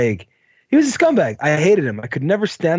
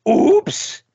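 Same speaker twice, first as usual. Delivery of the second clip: mock oops; 13 semitones higher than usual. His own fake accidental drop.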